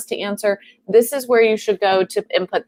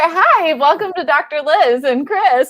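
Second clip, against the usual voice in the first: telethon host; 7 semitones higher than usual. Pretend. Co-host mocks greeting callers in a telethon host voice. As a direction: play a telethon host taking calls. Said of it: "like a telethon".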